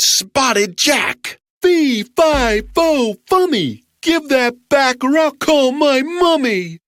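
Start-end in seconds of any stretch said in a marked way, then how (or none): 1.6-6.8 high loud booming giant; giant roar. Acted. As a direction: roar the rhyme at the thief. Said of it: "roared the giant".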